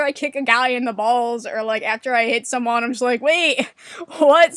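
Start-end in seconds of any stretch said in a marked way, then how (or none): 3.2-4.5 loud, higher-pitched startled question; mock bewilderment. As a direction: act baffled by what just happened. Acts out shock at own hit.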